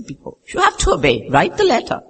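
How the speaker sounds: high-pitched imitation of a firm mentor; insistent mentor. Mentor telling a reluctant believer to obey, high and insistent.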